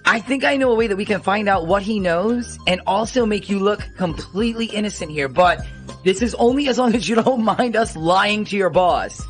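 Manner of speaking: normal talking voice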